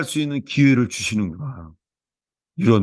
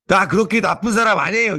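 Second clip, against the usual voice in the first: put-on higher, indignant little child protesting; mock angry child; 7 semitones higher than usual. Five-year-old snapping back at the pastor, mock indignant. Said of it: "화를 내면서 이러는 거 있죠".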